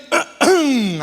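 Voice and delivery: loud, drawn-out throat scrape; throat clearing. Acted. Grandson mimicking grandpa's famous throat scrape, exaggerated throat clearing. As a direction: imitate an old smoker hacking his throat clear. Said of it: "a tal da raspada de goela".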